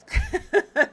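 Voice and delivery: short high-pitched laugh; quick staccato burst of high laughter; real bubbly laugh. Real, not acted. Laughing at the echo chamber.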